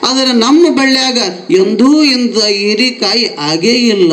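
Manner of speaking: usual speaking voice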